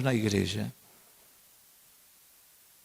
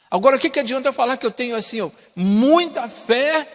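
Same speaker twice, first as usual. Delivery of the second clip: mocking boast; 10 semitones higher, 5 dB louder than usual. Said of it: "preacher mocks empty claim".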